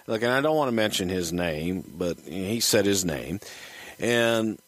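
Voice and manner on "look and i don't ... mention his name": ordinary talk, nothing marked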